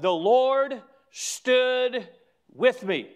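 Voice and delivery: very slow, weighty, each word held out; drawn-out, ringing declaration; emphatic scripture quote. Acted. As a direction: proclaim it slowly, pausing before the last words. Preacher savors a scripture statement.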